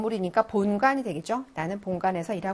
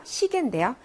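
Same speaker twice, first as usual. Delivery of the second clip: ordinary delivery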